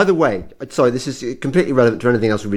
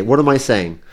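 ordinary talk

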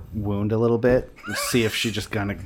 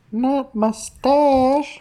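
tiny voice, much higher and very slow; tiny tinkling snowflake whisper; 5 dB louder, much slower than usual. Acted. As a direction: squeak it out like a tiny creature. Tiny voice from air ducts.

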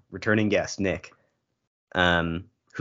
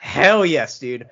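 loud excited hell yes; excited cheer; 4 semitones higher, 5 dB louder, much slower than usual. Real, not acted.

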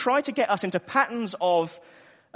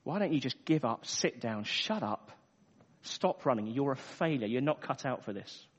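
accusing voice; 7 semitones lower, 9 dB quieter than usual. Acted. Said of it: "I'm sure you've heard that voice before".

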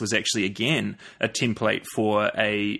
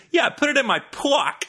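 much higher, hammed-up Australian boy; mock botched Australian vowel; bad Australian accent; 3 dB louder than usual. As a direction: mimic a kid failing at an Australian accent, "pack" coming out as "pock". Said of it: "worst attempt at an Australian vowel".

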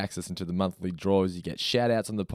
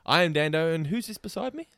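higher, rising show-intro delivery; redone intro; 7 semitones higher than usual. Pretend. Host redoing the intro for his co-host, higher and questioning. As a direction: lift it into a cue for the co-host.

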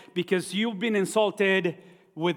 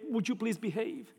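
higher, softer mock plea; begging; 3 semitones higher, 8 dB quieter than usual. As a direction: plead gently, as if begging a child. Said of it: "begging and say".

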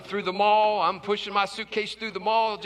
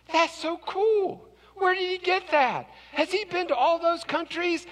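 admiring stranger; 8 semitones higher than usual. Retold or imitated.

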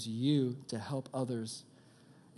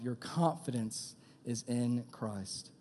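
slow, stressed on in; slow emphatic repeat; 4 dB quieter, slower than usual. Shown as a demonstration. Preacher repeating the key line slowly for the youth. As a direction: lean on every word. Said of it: "slow repeat for emphasis".

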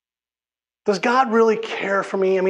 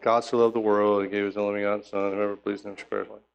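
lower, softer, rushed mumble; rote mumbled reading; 9 semitones lower, 6 dB quieter, faster than usual. Shown as a demonstration. Preacher mocking a bored reading of scripture, quick soft mumble. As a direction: rattle it off under your breath like a chore. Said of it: "There's a huge difference between just going like".

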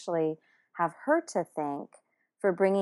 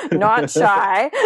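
louder, laughing through the words; 10 dB louder than usual. Real, not acted. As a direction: say it while you laugh. Laughing about not being shy.

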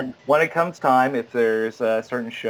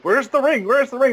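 high, loud frantic cry; mock panic; 12 semitones higher, 4 dB louder than usual. Actor who missed a cue hunting the ring, mock panic. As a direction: call out in a hammed-up fluster.

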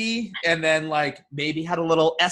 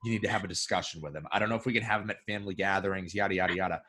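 low, soft fussy mother-in-law voice; mock mother-in-law; 7 semitones lower, 8 dB quieter, faster than usual. Imitating his mother-in-law complaining to her daughter, low and soft. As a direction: grumble like a worried mother-in-law, lower and hushed. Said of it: "most mother-in-laws would probably".

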